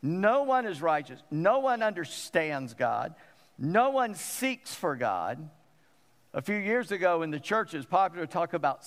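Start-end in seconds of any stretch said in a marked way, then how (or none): 0.0-5.5 each "No one" hit firmly, slowing as it goes; emphatic. Retold or imitated. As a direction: drive home every "No one" with deliberate weight. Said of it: "now he's emphasizing it".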